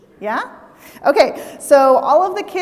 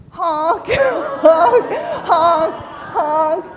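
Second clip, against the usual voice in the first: laughing goose honks; 4 semitones higher, 4 dB louder, much slower than usual. Shown as a demonstration. Leading kids honking as geese.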